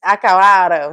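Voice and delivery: Acabado said loud as "akawaro", B swallowed; imitating a missing B. Mocking the word as heard on the voice note, B dropped. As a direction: mimic what you hear, no B. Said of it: "That's what I'm hearing".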